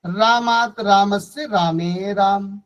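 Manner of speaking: slow, drawn-out रामात रामस्य रामे राम; model recitation. Shown as a demonstration. Teacher leads class through remaining forms, slow recital. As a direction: stretch each form evenly for pupils to repeat. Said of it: "जैसे हम एक बार पढ़ेंगे".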